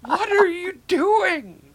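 loud, high, slow astonished outcry; mock the shocked panel, loud and high; shocked disbelief. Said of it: "everybody just stops and they're like".

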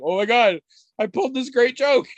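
imitating a gleeful little boy; proud toddler. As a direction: crow it like a kid proud of his prank. Toddler proud of his joke.

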